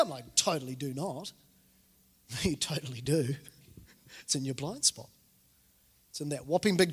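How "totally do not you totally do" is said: low, softer dismissive denial; quiet, low retort; mock denial. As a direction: deny it under your voice, half-joking. His denial to family, retold.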